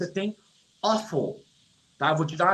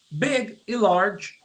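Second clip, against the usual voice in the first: slow word pair; 3 semitones higher, much slower than usual. Shown as a demonstration. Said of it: "teacher reads word pair".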